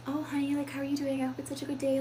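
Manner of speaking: mock sugary mom voice to her child; soft airy voice. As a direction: coo it gently to a daughter. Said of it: "it's soft, it's airy, it's higher".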